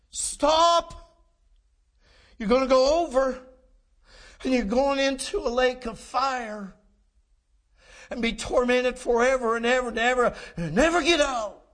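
high, forceful preaching cry; impassioned warning. Real, not acted. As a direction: thunder an urgent warning at the congregation. Preacher warns the unsaved in earnest.

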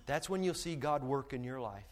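ordinary talk

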